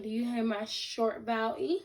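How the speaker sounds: ordinary talk, nothing marked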